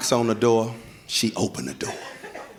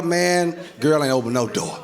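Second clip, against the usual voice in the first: ordinary talk, nothing marked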